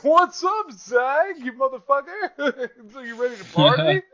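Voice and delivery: put-on loud, very high drunken party god; Dionysus. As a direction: whoop it like a boozed-up reveler, laughing midway. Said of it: "the drunk god of wine, and he's just like".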